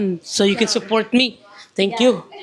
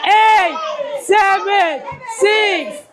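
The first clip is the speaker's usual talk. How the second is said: new year countdown crowd; 7 semitones higher, much slower than usual. Real, not acted. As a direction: count down to midnight, yelling each number. New Year countdown shouted together.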